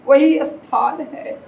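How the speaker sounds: वही drawn out slowly, rising; slow emphatic वही. Shown as a demonstration. Teacher repeating the example slowly, leaning on वही. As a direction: stretch वही slowly to show it is fixed. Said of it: "निश्चित है".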